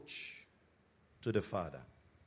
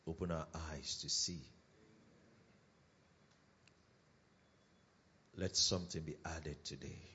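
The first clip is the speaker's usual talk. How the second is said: soft, low spoken prayer; quiet prayer; 12 semitones lower, 8 dB quieter, faster than usual. Real, not acted. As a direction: pray it gently. Opening prayer before sermon.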